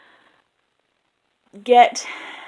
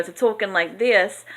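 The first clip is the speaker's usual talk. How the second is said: a bit louder New York accent; 4 dB louder than usual. Shown as a demonstration. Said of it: "go from this".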